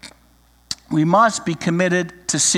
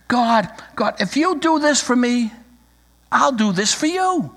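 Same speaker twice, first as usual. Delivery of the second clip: higher, wheedling bargain with God; mock childish prayer; 7 semitones higher than usual. Believer bargains with God, a higher mock wheedle. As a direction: pray like a child making a deal.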